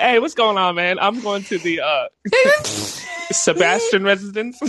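high, overly polite voice; mock posh arrival. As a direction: pretend to belong at the gate. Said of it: "be like".

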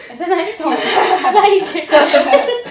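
high, loud and very slow through laughter; drawn-out laughing approval. Real, not acted. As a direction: giggle the compliment out. Laughing compliment.

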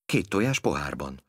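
usual speaking voice

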